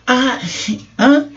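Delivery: high, rising "Ah, hã?" of disbelief; like you didn't catch what was said; puzzled hã. Shown as a demonstration. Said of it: "Pergunta de quem não entendeu ou não acreditou". (interjeição de quem não entendeu)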